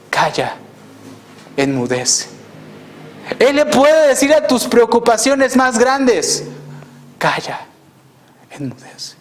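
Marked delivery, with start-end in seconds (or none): 0.1-2.3 low, softly settled, tranquil words; calm command. Retold or imitated. Preacher voices the Lord speaking to a troubled heart, calm and low. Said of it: "con mucha tranquilidad". 7.2-9.2 low voice sinking to a near whisper; tranquil hushed command. Retold or imitated. Preacher voices the Lord quieting your biggest worries, hushed and calm. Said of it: "con mucha tranquilidad".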